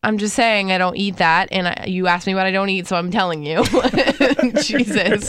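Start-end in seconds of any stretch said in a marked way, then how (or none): 3.6-5.3 exasperated but amused, breaking into laughter; laughing exasperation. Real, not acted. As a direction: laugh through the exclamation. Laughing at the teasing exchange.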